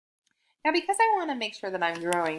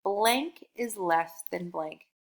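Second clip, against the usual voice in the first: slow, spelled-out sentence frame; slow model sentence; much slower than usual. Shown as a demonstration. Teacher to young learner, slow sentence frame. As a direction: lay out each word for the child. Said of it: "write out this sentence".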